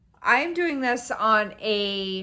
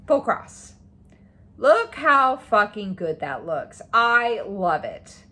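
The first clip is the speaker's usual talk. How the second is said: loud, slow gushing; excited; 8 dB louder, slower than usual. Real, not acted. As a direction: relish every word. Gushing over finished page.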